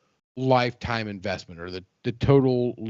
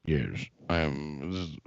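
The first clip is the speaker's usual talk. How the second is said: mock Russian accent; 9 semitones lower, 8 dB quieter than usual. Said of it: "trying to say something in a Russian accent".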